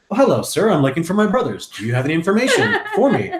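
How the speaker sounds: high-pitched polite little lad voice; Tiny Tim. Acted. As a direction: play an eager game hero asking around. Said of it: "that's my Tiny Tim, uh, accent".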